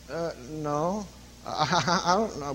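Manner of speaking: soft, stammering mock uncertainty; quiet, faltering, unsure; hesitant husband. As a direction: imitate a cornered husband fumbling for an answer. Mimics husband cornered by preacher's question.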